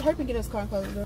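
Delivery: rapid-fire order to get in the car; urgent hurry-up. Real, not acted. Mom hurrying her son into the car, fast and urgent. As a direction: rush it out like a worried mom.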